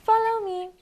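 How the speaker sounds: very high squeak; squeaking mouse. Acted. Mouse leading the foxes, very high. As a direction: squeak it bright and short. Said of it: "squeaked the mouse".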